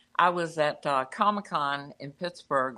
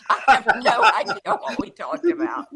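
laughing; 12 semitones higher, 8 dB louder than usual. Real, not acted. Laughing at own blank-out.

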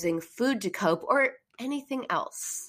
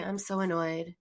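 softer, flat, emotionless statement; unfeeling; 2 semitones lower, 5 dB quieter than usual. Shown as a demonstration. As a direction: say it as a thought, with no affect. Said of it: "you're thinking it".

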